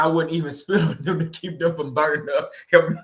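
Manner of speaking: trying not to laugh. Real, not acted. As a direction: crack up helplessly mid-sentence. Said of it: "I'm not supposed to be laughing".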